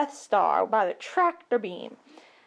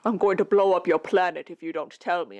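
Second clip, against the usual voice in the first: menacing Vader; 6 semitones lower, faster than usual. Acted. Vader threatening Leia, low menacing voice. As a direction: threaten like a dark lord.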